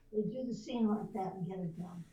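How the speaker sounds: normal talking voice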